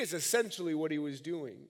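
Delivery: ordinary talk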